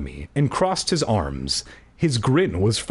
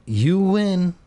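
sadly; 7 semitones higher than usual. Acted. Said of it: "I repeated sadly".

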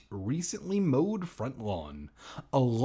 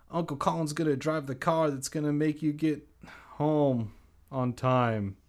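silly aside voice; 3 semitones higher than usual. Real, not acted.

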